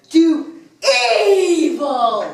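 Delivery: loud, high, long drawn-out proclamation; villainous vow. Acted. Villain dedicates his life to evil, grandly proclaiming. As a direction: proclaim it hugely, stretching the word.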